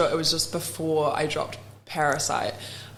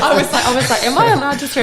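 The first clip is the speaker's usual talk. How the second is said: laughing, higher and louder; laughing retelling; 8 semitones higher, 9 dB louder, faster than usual. Real, not acted. Retelling her swearing question to host, laughing. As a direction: giggle through the question.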